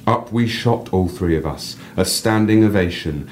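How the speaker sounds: reading a personal story aloud, lively. A narrator's reading. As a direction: read your piece to the listener with feeling. Reads own story aloud.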